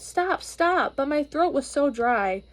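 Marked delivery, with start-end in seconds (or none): 0.0-1.0 a high, pleading cry of stop; mock shout. Girl tries to stop the firemen, high mock shout. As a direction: call out to make the hoses stop. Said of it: "I tried to shout".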